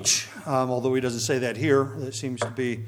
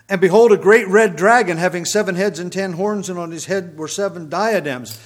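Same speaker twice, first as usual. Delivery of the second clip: louder, deliberate reading of the verses; scripture reading; 5 semitones higher, 8 dB louder than usual. Acted. Bible teacher reading Revelation to his class, louder and deliberate. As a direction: read the Bible passage out with weight. Reads scripture passage aloud.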